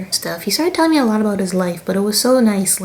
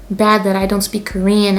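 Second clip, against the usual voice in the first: ordinary talk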